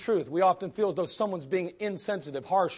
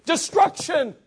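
forceful warning; 7 semitones higher, 6 dB louder than usual. Acted. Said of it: "then we need to say".

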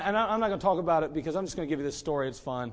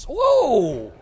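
loud high laughing whoa of sudden pain; startled yelp; 6 semitones higher, 9 dB louder than usual. Retold or imitated. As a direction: yelp as if the iron just touched you. Getting burned by the iron.

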